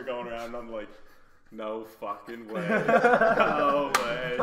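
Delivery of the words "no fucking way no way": disbelief. Retold or imitated. Seeing the search helicopter.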